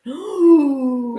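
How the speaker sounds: loud, high drawn-out "ooh"; gasp, then a long rising ooh; startled gasp. Real, not acted. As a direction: recoil at a confronting idea. Reacts to advice to be bored.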